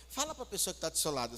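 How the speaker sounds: ordinary talk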